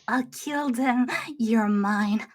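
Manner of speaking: low, slow menace; dangerous Laura voice. Pretend. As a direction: make the threat feel dangerous. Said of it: "You wanna sound dangerous".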